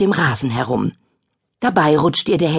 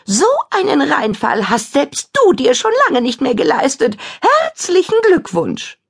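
high gloating witch; 10 semitones higher, 4 dB louder than usual. Acted. Kadabra keeps gloating.

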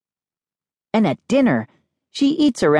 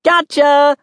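high, gleeful gotcha; cried out; 11 semitones higher, 4 dB louder than usual. A narrator's reading. Kid who fooled sister, crying out in triumph. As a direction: crow over a successful trick. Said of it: "I cried".

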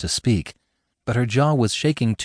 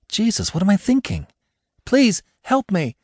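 frightened pleading; 11 semitones higher than usual. Acted. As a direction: plead in fear. Panicked woman pleading.

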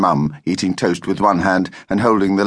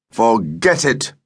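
slow, firm refusal with little extra volume; understated fatherly yell; mild yell; 3 semitones higher, slower than usual. A narrator's reading. Father refuses his daughter's travel plan, mildly yelled. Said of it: "yelled Dad".

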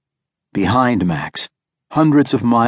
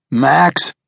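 restrained yell, pitched higher; 6 semitones higher, 3 dB louder than usual. A narrator's reading. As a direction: call out to rouse your son. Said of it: "Victor yelled".

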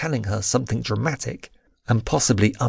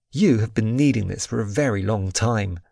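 usual speaking voice

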